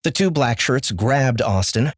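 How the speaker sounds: usual speaking voice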